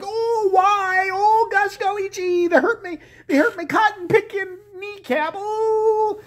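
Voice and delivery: high wailing Southern whimper; fake-cry like she's hurt; mock wailing Palin. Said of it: "Sarah Palin like".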